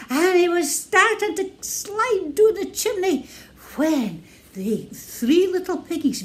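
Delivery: plain reading voice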